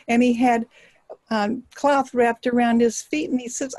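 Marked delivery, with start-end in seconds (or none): none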